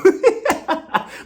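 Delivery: high-pitched burst of laughter. Real, not acted. Laughing at own joke about tickets, high laugh. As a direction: cackle at your own joke.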